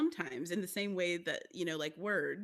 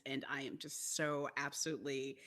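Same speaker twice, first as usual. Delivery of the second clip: ordinary talk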